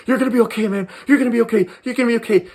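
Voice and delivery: put-on a scared young medic soothing; nervous reassuring. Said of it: "nervous and so scared".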